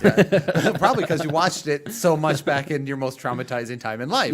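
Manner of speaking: talking through real laughter, loud; loud, high, amused outburst; laughing. Real, not acted. Both laughing over the hated movie.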